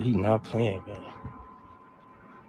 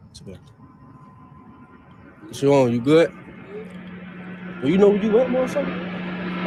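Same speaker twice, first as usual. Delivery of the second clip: loud, confronting street tough; tough guy act; 12 semitones higher, 5 dB louder than usual. Pretend. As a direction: get in their face like a menacing stranger. Prank persona confronting stranger.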